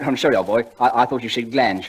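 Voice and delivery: ordinary delivery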